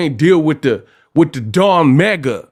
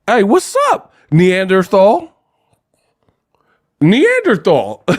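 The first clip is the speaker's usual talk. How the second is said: high hail, then a drawn-out insult; mock gangster; 5 semitones higher, slower than usual. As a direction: stretch the silly censored insult, pitched up. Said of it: "Ain't nobody saying".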